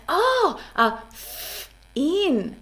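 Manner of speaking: "f-" breathed out unvoiced before "in"; F sound demo. Shown as a demonstration. Therapist modeling the F for a child, airy f. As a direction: blow the air on "f-" then "in". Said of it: "And I'll say".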